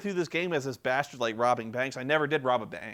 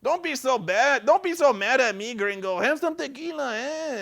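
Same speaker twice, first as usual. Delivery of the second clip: Mexican warlord; 12 semitones higher, 6 dB louder than usual. Retold or imitated. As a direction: sweet-talk him like a hammed-up bandit. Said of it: "He's like".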